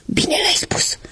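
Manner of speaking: a high, breathless, thrilled whisper; delighted whisper. Acted. Right shoe cheering on the left shoe, whispered. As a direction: whisper your delight to your brother. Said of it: "șopti încântat".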